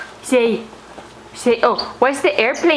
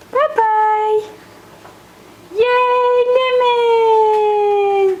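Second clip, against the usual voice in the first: excited cheer; 11 semitones higher, much slower than usual. Real, not acted. Cheering baby at end.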